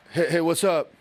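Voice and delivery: half-hearted greeting. Retold or imitated. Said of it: "what I call a half step".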